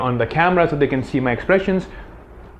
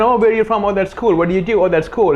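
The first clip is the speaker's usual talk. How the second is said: higher-pitched breezy small talk; small-talk chatter; 7 semitones higher than usual. Shown as a demonstration. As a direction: chat politely, pitched up, with no intent. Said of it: "instead of just having that conversation".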